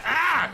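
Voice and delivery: high, loud shriek; short loud scream; startled yelp. Real, not acted. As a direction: yelp as it charges. Fleeing the angry bear.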